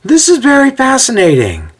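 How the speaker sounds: very high-pitched heckler's voice; calling out. A narrator's reading. A stranger in the crowd to Paul, calling out. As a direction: pipe up from among many listeners. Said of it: "Calling out from the crowd, a voice says".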